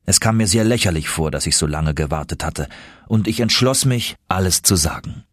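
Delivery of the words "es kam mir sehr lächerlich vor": plain reading voice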